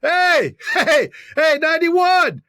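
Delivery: high, hollering mock fan; heckler's call. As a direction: call out to a player across the field. Acts out researched heckling at player.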